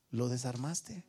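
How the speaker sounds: usual speaking voice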